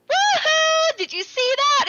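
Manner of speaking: high, loud gleeful whoop; Mahito. Retold or imitated. Villain celebrating a kill in front of Itadori, whooping and elated. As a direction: crow over the kill in mock delight. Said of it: "like, Mahito goes".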